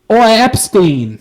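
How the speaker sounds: loud, slow, high British call; Prince Andrew, mock British. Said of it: "Here's my best Prince Andrew".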